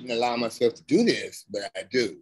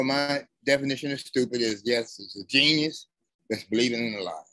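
ordinary talk, nothing marked

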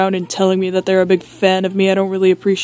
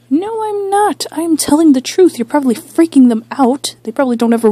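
high, louder indignant spouse; arguing spouse voice; 7 semitones higher, 4 dB louder than usual. Acted. Spouse arguing with Dan about his viewers, high indignant voice. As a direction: protest in a pitched-up spouse voice. Spouse argues back with host.

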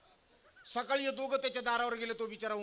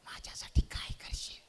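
put-on whispered girlfriend voice. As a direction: whisper like a girlfriend hiding under the sheet. Girlfriend asking.

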